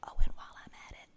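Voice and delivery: quick whispered aside, then loving; tender whisper. Acted. God whispering love to Job, tender whisper. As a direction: whisper I love you softly. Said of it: "I added that part".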